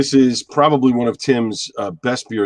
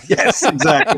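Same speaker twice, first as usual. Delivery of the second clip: laughing; 7 semitones higher, 4 dB louder than usual. Real, not acted. Laughs at Italian joke.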